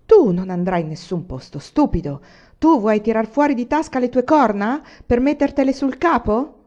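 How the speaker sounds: loud, emphatic scolding; energetic rebuke. A narrator's reading. Wife forbidding the miller to go, energetic and scolding. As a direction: put your foot down forcefully. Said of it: "osservò energicamente la donna".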